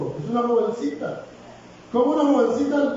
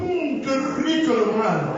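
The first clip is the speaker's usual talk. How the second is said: mock indulgent relish; 4 semitones higher than usual. Sinner savouring sin.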